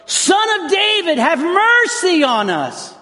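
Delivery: high, slow pleading cry; desperate shout. Retold or imitated. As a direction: cry out as if for your life. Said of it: "shouting at the top of their lungs".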